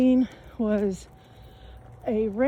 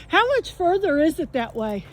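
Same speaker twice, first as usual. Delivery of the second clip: raised, higher voice to a passer-by; calling out; 7 semitones higher, 5 dB louder than usual. Real, not acted. Walker asking a passer-by the distance, calling out. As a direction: call the question across the trail.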